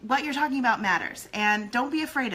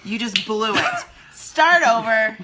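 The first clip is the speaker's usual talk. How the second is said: mock command; 7 dB louder, slower than usual. Playful mock order to restart.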